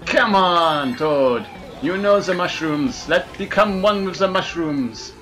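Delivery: put-on comic French accent all through the race. Racing in Mario Kart, talking to viewers, in a comic French accent. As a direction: play it as a cheeky Frenchman racing.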